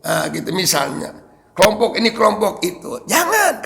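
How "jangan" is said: Jangan thrown up high in a sharp quoted warning; the Kiai's warning quoted. Retold or imitated. Preacher quoting the Kiai's ban to the congregation, sharp high warning. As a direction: cry out Jangan as the Kiai forbidding it. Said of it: "kata Syekh, kata Kiai Maksum".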